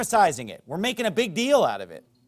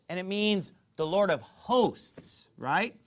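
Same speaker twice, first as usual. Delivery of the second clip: ordinary talk, nothing marked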